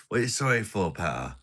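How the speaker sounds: ordinary delivery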